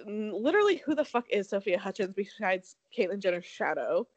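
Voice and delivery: ordinary talk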